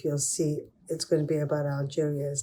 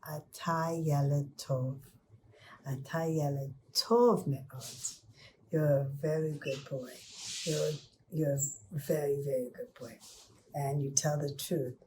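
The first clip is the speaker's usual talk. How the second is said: soft, hushed, partly whispered praise; tender praise; 3 dB quieter than usual. Acted. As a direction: praise the honest boy gently. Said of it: "very happy with this little boy".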